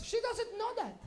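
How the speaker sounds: badmouth the friend after she leaves; spiteful mom mutter.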